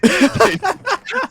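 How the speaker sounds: loud, high laugh. Real, not acted. Laughing at trolls who think insults work, loud high laugh.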